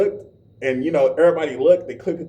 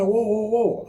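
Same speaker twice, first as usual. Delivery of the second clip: startled whoa; 6 semitones higher than usual. Retold or imitated. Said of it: "And I'm like".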